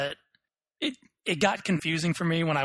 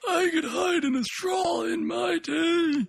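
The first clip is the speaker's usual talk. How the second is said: put-on high, slow creaky old-timer; old man voice; slower than usual. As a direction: reminisce like a rickety grandpa. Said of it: "You sound like an old man ninja".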